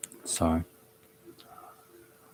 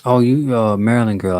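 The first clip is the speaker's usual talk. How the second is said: louder, higher real call-out; raised voice of sudden recognition; 4 semitones higher, 8 dB louder than usual. Real, not acted. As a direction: call out on spotting someone you know. Spots a viewer, calls to her.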